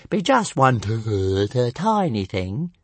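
high, slow stuttering dragon voice; stammering little dragon. A narrator's reading. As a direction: confess bit by bit.

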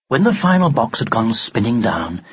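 usual speaking voice

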